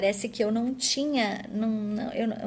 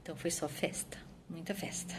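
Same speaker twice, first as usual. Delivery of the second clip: whispered; 2 semitones lower, 11 dB quieter than usual. Real, not acted. Fond memory of celebration.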